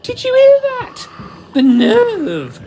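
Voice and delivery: very high, loud hammed-up outrage; mock shock. Compton playing to the audience, hammed-up shocked squeal. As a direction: play up fake indignation to the crowd. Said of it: "mock shocked face at the audience".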